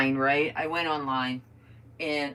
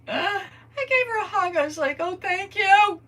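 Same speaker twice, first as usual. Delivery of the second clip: mock tearful gratitude; 9 dB louder than usual. Customer thanking the theater worker, mock-tearful relief. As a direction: wail it loudly like overjoyed sobbing.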